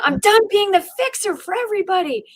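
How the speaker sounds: high, loud fed-up outburst; exasperated rant reaching its peak; escalating rant. Retold or imitated. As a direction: explode at carrying everyone. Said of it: "my mind's escalating".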